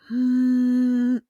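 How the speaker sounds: long, steady hum on one note; humming demo. Shown as a demonstration. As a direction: hold a single even hum. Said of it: "can hum".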